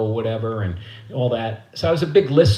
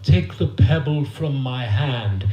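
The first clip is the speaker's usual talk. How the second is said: lightly voiced kung fu sage, very slow; a hint of martial-arts guru; spiritual kung fu master; slower than usual. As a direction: intone it slowly like a philosophical master. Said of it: "they'd say all this spiritual".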